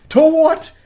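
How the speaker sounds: very high, loud yell; incredulous yell. Real, not acted. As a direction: blurt it out in disbelief. Ranting about his horniness.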